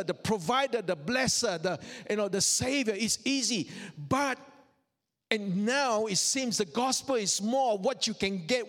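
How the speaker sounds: normal talking voice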